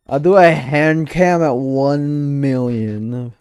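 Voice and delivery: loud, slow, drawn-out announcement; hyped promise. Real, not acted. Streamer promising chat a hand cam, slow and loud. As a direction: stretch out the big promise.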